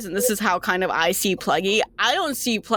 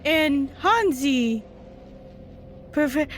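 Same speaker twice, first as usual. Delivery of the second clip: high, hungry monster voice; creepy creature voice; 6 semitones higher than usual. Acted. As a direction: purr it like a greedy beast.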